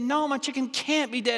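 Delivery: high-pitched protest, mock desperate; acted denial. Woman arguing with vet over dead chicken, desperate denial. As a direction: refuse to believe it, voice jumping up. Owner refuses vet's news in joke.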